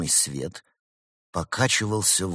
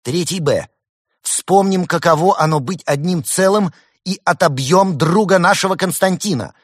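very high, loud battle call; ringing high-pitched call to charge; rallying command; 11 semitones higher, 10 dB louder than usual. A narrator's reading. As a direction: shout orders to rally the whole class. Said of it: "И тогда Марина скомандовала".

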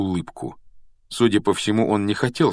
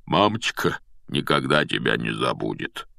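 low, choked-up goodbye; voice breaking; 8 semitones lower, 4 dB quieter than usual. A narrator's reading. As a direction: drop low and force out a tearful farewell. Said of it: "срывающимся голосом выдавил из себя Хагрид". (низким срывающимся голосом)

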